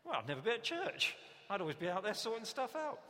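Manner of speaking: loud, high-pitched hammed-up objection; mock protest. Preacher mimics churchgoer objecting to sorting stuff out, mock protest. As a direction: protest loudly as an exasperated churchgoer.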